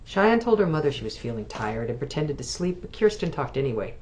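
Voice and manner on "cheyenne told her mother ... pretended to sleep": plain reading voice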